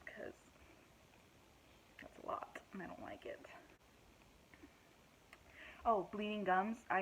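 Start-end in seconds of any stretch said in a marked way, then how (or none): 0.1-3.4 whispered aside. Real, not acted. Embarrassed aside about weight gain.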